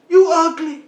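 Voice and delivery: high squawky parrot taunt; high mocking parrot. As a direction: sneer it like a rude parrot. Parrot voiced in a joke.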